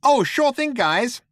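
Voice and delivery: very high, obliging computer voice; cheery shipboard computer; chirpy computer. A narrator's reading. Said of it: "said the computer".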